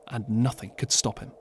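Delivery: usual speaking voice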